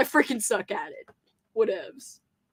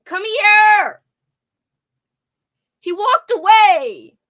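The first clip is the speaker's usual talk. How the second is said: shouting; 8 semitones higher, 9 dB louder, slower than usual. Real, not acted. Calling Paul, he walks off.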